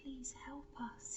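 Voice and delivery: slow whispered plea from a wolf; slow, soft frightened whisper. Acted. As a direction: beg in a breathy hush. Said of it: "he whispered".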